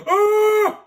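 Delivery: very high, loud shriek of surprise; startled gasp and shout. Real, not acted. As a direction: jump and shriek at a sudden zombie. Startled by zombie in game.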